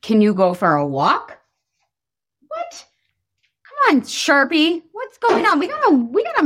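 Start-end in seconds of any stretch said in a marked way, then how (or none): 2.5-2.8 startled what. Real, not acted. Surprise at gray marker.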